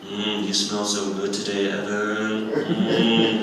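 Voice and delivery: soft, low seductive murmur; mock-seductive murmur to an opponent; sweet nothings. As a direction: purr it into his ear. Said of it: "whisper sweet nothings into his ears".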